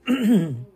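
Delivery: clears a tight, emotional throat; clearing the lump in her throat; choked throat clear. Real, not acted. Telling followers she loves them, a choked throat clear. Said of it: "I'm getting choked up".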